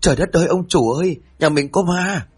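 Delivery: panicked yell. Acted. The maid yells to the master about a ghost, high panicked cry. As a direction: scream for the master to come. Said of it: "Miệng la lớn hơn cả bà Nguyệt".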